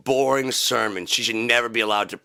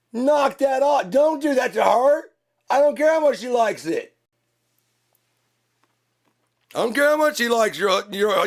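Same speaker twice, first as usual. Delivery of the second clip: angry yelling; 10 semitones higher than usual. Acted. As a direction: yell it at the nosy neighbour. Yelling at nosy neighbour.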